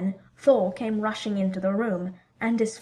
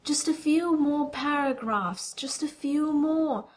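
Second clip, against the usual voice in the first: slow, high, pleading murmur; 6 semitones higher, slower than usual. Acted. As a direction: drag it out, begging for time. Jane begging to finish reading.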